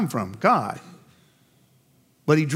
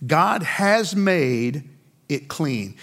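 "has" drawn out and stressed, whole phrase very slow; slow emphasis; much slower than usual. Shown as a demonstration. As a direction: stretch out "God has made it clean". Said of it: "'cause it's in the past tense".

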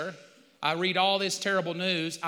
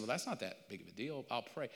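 much softer, quick and offhand; breezy dismissal; 7 semitones lower, 12 dB quieter, faster than usual. Retold or imitated. Friend shrugging off his huge problem, offhand. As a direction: brush it off lightly.

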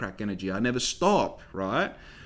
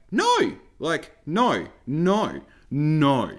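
indignant no; 3 semitones higher, 4 dB louder, much slower than usual. Retold or imitated. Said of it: "in my mind".

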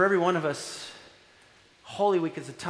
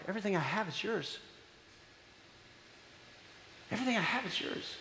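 tender father; 10 dB quieter than usual. Retold or imitated. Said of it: "And the dad's like".

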